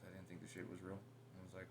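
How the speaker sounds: ordinary talk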